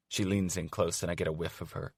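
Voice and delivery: usual speaking voice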